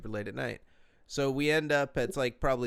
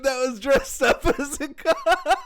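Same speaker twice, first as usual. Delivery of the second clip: high, loud laughing talk; cracking up at his own punchline; laughing hard; 14 semitones higher, 10 dB louder, slower than usual. Real, not acted. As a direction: get the words out through laughter.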